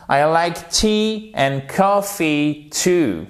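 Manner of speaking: very slow, careful English sentence; slow model sentence. Shown as a demonstration. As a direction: read it slowly so learners catch "too". (phrase modèle très lente)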